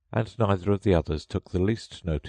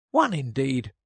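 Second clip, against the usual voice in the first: indignant; 8 semitones higher than usual. A narrator's reading. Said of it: "said the Dormouse indignantly".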